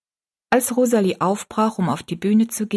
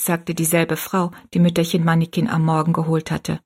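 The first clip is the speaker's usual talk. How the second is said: ordinary delivery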